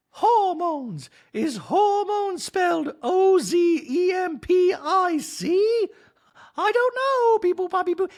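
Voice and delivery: very high, loud, hammed-up impression; Dorit. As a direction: squeal it slowly with mock finger quotes. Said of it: "Dorit ... in the talking head and goes".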